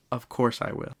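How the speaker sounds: ordinary talk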